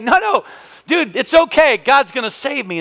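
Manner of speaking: high, breezy no thanks; man on the roof telling the jet ski rider he's fine; stubborn refusal. Retold or imitated. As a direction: wave off the rescuer, sure of God.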